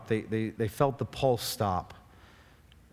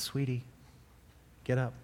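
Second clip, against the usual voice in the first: soft, gentle coaxing; tender, hushed parent's voice; tender wake-up; 6 dB quieter than usual. Retold or imitated. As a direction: say it softly, as if waking a sleeping child. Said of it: "It takes a lot of tenderness".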